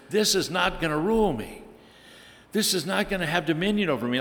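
defiant resolve. Pretend. Resolving against sin.

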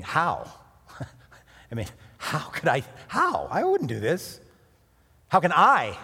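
How leapt up high and loud, then I punched; rising indignant stress on How and I; emphasizing each word. Shown as a demonstration. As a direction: hammer How and I in turn. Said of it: "You can emphasize everything".

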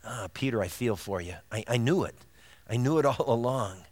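soft, hushed, tender and knowing; Jesus gently forgiving Peter, soft tender hush. Retold or imitated. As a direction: speak gently as Jesus to Peter, with a small laugh. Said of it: "it was a look of".

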